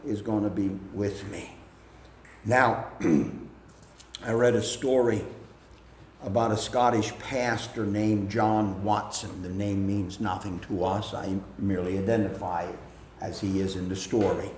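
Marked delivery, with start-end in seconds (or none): none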